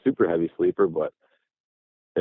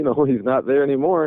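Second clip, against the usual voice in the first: higher, with a light laugh through it; 6 semitones higher than usual. Retold or imitated. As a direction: toss it off with a nervous chuckle. Retelling a spooky moment with a laugh.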